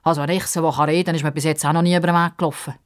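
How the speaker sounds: plain reading voice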